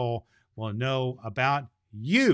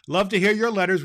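plain reading voice

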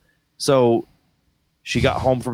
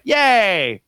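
high, drawn-out mock yay; hammed-up celebration; mock cheer; 10 semitones higher, 3 dB louder than usual. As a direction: cheer like handing out a participation prize. Acting out a grade's meaning.

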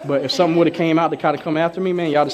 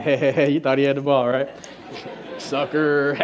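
mocking laugh; 3 semitones lower than usual. Mocking fooled tackler, laughing.

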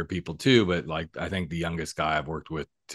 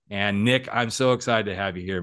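normal talking voice

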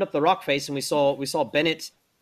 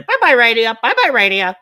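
high, loud squawking parrot voice; 8 semitones higher, 11 dB louder than usual. Acted. The pet parrot squawking at the player, high parrot voice.

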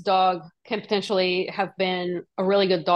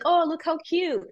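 cooing; 9 semitones higher than usual. Retold or imitated. People cooing over a dog.

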